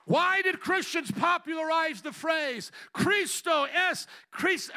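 loud, rousing preaching; rally speech. Real, not acted. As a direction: build it into a fiery rally cry. Preaching builds to full force.

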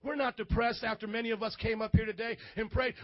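ordinary delivery

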